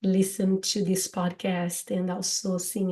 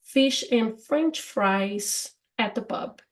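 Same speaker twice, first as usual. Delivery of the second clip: example sentence; slower than usual. Shown as a demonstration. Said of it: "in American English would be".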